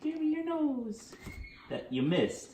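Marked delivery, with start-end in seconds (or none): 0.0-1.1 high, slow coaxing voice; talking to a dog. Real, not acted. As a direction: sweet-talk the dog into the trick. Asking the dog for a trick.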